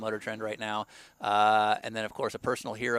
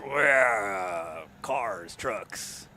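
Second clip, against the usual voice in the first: low, drawn-out arena announcer; monster truck ad; 4 semitones lower, much slower than usual. Pretend. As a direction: hype it like a monster truck promo. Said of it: "Monster truck voice".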